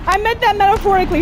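fast flustered excuse to the beast; panicked backpedal. Real, not acted. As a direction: blurt it out to take it back.

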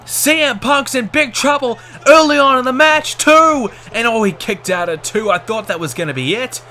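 excited, loud play-by-play shouting; wrestling commentator. Acted. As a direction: call the finish like a ringside announcer. Live match play-by-play.

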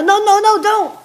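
high, loud rapid-fire plea; alarmed protest. Pretend. Friend stopping someone turning it on, alarmed shout. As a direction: shout to stop them in mock panic.

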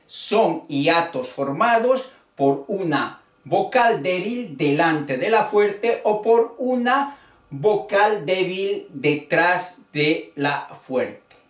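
normal talking voice

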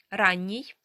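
ordinary talk, nothing marked

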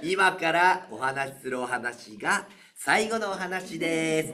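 slow, drawn-out, overly solemn; exaggerated announcement. Shown as a demonstration. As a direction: stretch it out to bait the groan. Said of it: "わざとらしく言うからね". (聴衆をあおるように、わざとらしくゆっくり)

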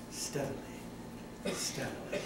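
a hushed, creeping whisper; whispered steadily. Acted. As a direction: breathe the words out as you creep. Creeping the door open.

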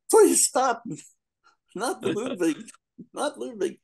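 high, pleading mock Alex. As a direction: beg like Alex strapped in the chair. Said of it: "Alec in, Clockwork Orange".

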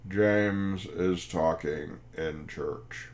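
very slow, word-by-word accusation; grave nun's complaint. Retold or imitated. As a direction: spell out the offence slowly, imitating a stern nun. Retold complaint from the nun.

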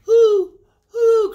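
very high, loud hooting; owl hoot. Acted. Owl calling out at night, very high loud hoots. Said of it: "cried the owl".

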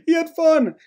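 mock whining to the coach in a high voice; tattling Maldonado. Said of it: "Maldonado's like".

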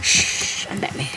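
hushed shushing whisper. Pretend. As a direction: whisper it like a sneaky hero. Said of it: "You could be like".

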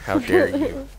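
high, indignant how dare you; mock outrage. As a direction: play it scandalised and shrill.

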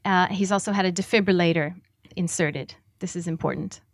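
usual speaking voice

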